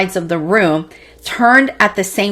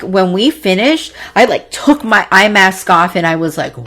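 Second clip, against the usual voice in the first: ordinary talk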